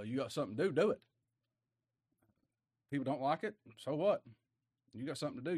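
ordinary talk, nothing marked

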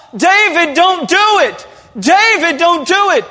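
high, loud, desperate shouting; yelling out. Acted. Preacher yelling up at David on the balcony, desperate. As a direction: yell it out to stop him from afar. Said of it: "if we could just yell out".

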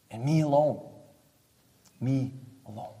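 hushed, intimate plea, dropping almost to a whisper; soft voiced Jesus. Jesus quietly pleading with religious people, hushed. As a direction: draw them in quietly, as if confiding.

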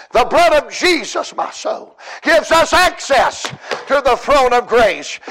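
high, loud, rising preacher's cry; full-force preaching. Acted. Preacher urging the congregation to call on God, full-force preaching. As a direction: preach it out to the whole church, pleading.

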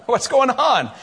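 high laugh into a chuckling question; laugh, then ask through it. Retold or imitated. Friend teasing pastor about trailer, laughing high. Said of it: "He laughed all the time".

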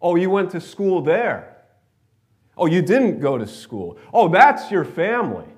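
loud, high mock disdain; mock judging. People judging someone's school and family, mock disdain. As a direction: exclaim it as if looking down on them. Acting out people who judge others.

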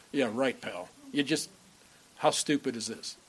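low, soft scornful "Yeah, right, pal". Retold or imitated. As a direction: sneer it under your breath in scorn. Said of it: "it's a scornful laugh".

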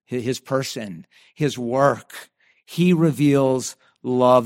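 ordinary delivery

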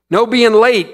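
loud, high call from the class; cried out. A narrator's reading. Another student crying out a rule, high and loud. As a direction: sing it out eagerly. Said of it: "cried another one".

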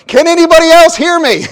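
loud, high punchline yell; loud comic cry for help. Acted. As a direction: shout it out to anyone else. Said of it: "the fellow started yelling".

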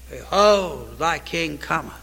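the preacher falls soft to let the verse sink in; hushed verse. Acted. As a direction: drop to awe after the shout. Hushed after the climax.

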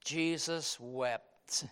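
slow, low and tender. Shown as a demonstration. Preacher dwelling on the verse, slow and tender. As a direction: let each word fall gently, full of compassion. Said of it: "This is a tender compassion".

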